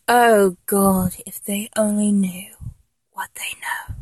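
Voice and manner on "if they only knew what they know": hushed secret whisper. Acted. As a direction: breathe it under your voice. Whispered aside.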